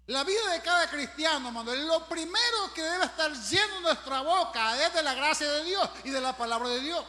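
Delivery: loud, high fervent preaching. Acted. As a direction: preach it out with fire. Preaching at full force.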